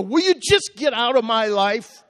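loud, high angry yell; hot-tempered outburst. Retold or imitated. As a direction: re-enact blowing up at a stranger. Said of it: "I was hotter than the pistol".

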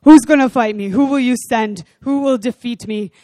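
loud taunting Goliath voice. Acted. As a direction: bellow it like a giant calling out the army. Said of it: "That's how I hear Goliath's voice".

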